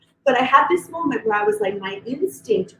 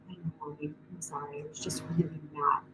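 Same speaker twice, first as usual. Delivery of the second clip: much softer, small sorry voice; soft child apology; 6 semitones lower, 16 dB quieter than usual. Retold or imitated. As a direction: voice the son's quiet apology. Son's apology voiced softly.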